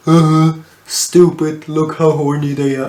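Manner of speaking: exaggerated mock-stupid voice. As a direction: mimic a dim-witted running joke. Mocking the episode's running joke.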